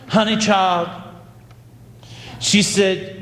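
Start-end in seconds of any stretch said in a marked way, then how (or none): 0.1-0.9 put-on light Louisville Southern drawl on honey child; a hint of a Kentucky Southern drawl; mild Southern Louisville drawl from the older friend. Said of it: "in her thick Southern Louisville drawl".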